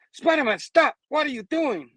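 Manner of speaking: very high, urgent yell; acted panicked protest; alarmed shout. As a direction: shout in alarm to stop a friend. Norman yells to stop him.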